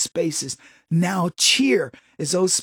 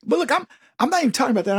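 ordinary talk

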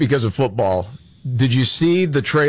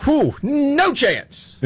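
high, slow, scoffing no chance; mock disbelief; much slower than usual. Mocking the pundit's confident take.

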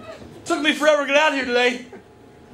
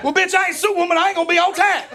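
screaming on cell phone; 8 semitones higher than usual. Retold or imitated. Bus passenger yelling into her phone behind him, high mock scream. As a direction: imitate a woman hollering into her phone. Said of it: "scream on her cell phone".

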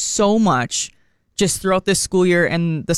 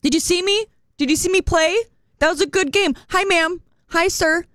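imitating her son; 9 semitones higher than usual. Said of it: "every person that walked past him".

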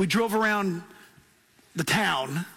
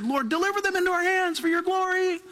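lifted prayer; 11 semitones higher than usual. Retold or imitated. Recalling praying over the valley with kids, high prayer. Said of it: "we said".

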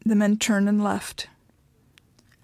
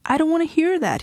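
shouting; 8 semitones higher than usual. A narrator's reading. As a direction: yell to shut her out. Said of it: "he shouted".

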